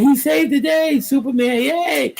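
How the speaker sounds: high, far-off cartoonish cheer for Superman; mock hero cheer. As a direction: cheer it like a kid watching cartoons.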